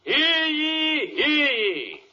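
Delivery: high, drawn-out crier's call; old-fashioned herald crying out to the court. Acted.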